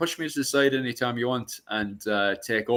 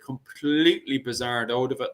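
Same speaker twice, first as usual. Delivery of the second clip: ordinary delivery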